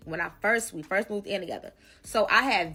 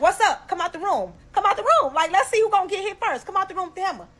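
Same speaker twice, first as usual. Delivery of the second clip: much higher, loud, furious yelling; angry challenge; 10 semitones higher, 5 dB louder than usual. Retold or imitated. Walking the hallway with a hammer to roommate, furious yell. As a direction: yell her out of the room. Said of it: "I'm walking down the hallway like".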